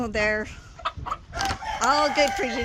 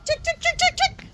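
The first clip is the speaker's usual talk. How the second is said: high sing-song chicken call. Real, not acted. Calling chickens to treats.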